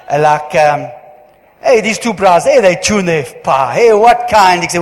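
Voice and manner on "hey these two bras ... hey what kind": loud, lively imitated Indian English accent; Indian dialect. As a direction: mimic an Indian storyteller with a loud, playful Indian accent. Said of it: "but in the Indian dialect".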